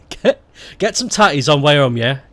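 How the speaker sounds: broad Stoke slang, "barrel"; Stoke dialect. Shown as a demonstration. Teaching a Stoke phrase in full dialect. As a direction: say it like a local lad. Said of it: "I shall buy some potatoes".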